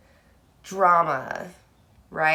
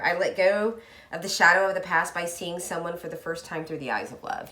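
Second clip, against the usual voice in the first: ordinary talk, nothing marked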